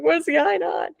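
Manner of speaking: high-pitched retold bewilderment; mock-helpless high voice; vulnerable confusion. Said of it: "I'm, like, really vulnerable".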